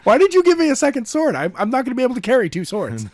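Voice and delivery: high, fast, whiny complaint; whiny hero. Acted. Voicing the game hero griping about two swords, whiny. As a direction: voice the hero's fretting, high and fast.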